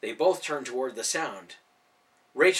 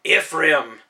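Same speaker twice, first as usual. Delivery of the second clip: loud correction; 2 semitones higher, 11 dB louder than usual. A narrator's reading. Ben corrects the name.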